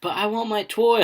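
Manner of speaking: put-on high, loud childish plea; whining kid. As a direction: imitate a little kid begging. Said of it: "one of our kids just like".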